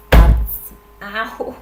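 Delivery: sudden sharp pain yelp; a loud cry of pain, cut off; hit hard crying out. Real, not acted. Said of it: "I just whacked my knee on my table".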